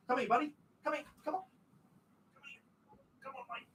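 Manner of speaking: soft, high coaxing to a pet; coaxing the dog. Real, not acted. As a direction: gently coax the dog to come over.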